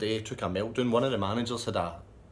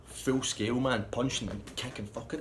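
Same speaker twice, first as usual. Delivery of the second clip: ordinary talk, nothing marked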